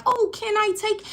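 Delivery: high-pitched mock cooing; cooing admirer. Someone walking up to a cute cat, cooing admirer.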